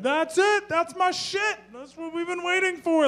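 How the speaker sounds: a dude overjoyed reading the Bible; ecstatic yell. Retold or imitated. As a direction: scream it like you found treasure. Tattoo guy's excited discovery.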